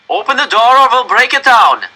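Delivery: put-on loud, high menacing German-accented threat; German officer. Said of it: "said another voice, this time in German".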